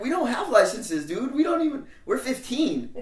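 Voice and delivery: put-on high, loud indignant teen protest; protesting kids. Fifteen-year-olds explaining they have no licenses. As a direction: blurt it out defensively. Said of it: "we're like".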